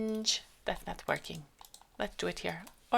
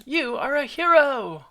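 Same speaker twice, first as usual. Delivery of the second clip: character line; 8 semitones higher, 6 dB louder than usual. Acted.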